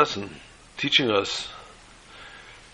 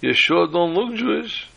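put-on skeptical old lady; 7 semitones higher than usual. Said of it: "with this funny look and says".

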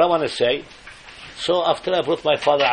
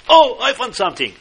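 high, loud surprised exclamation; sudden find; 9 semitones higher, 6 dB louder than usual. Retold or imitated. Rabbi acting out finding something, high surprised cry. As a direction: burst out as if stumbling on treasure.

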